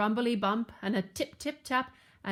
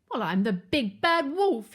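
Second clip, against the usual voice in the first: loud, higher wolf boast; howled; 4 semitones higher, 6 dB louder than usual. A narrator's reading. Wolf cannot believe it, howling loudly. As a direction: howl with outrage. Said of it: "he howled".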